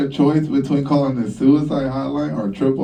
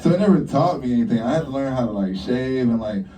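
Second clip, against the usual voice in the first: ordinary talk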